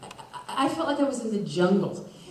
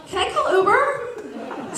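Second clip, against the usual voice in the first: laughing high plea; 9 semitones higher than usual. Pretend. Jokey plea while laughing.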